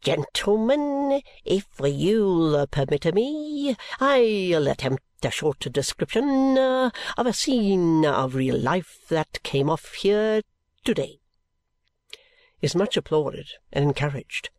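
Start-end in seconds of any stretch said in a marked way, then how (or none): 0.0-11.2 high, very slow comic showman; a pompous music-hall comic; Little Swills voice. A narrator's reading. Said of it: "Little Swills says".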